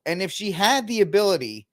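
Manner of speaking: ordinary talk, nothing marked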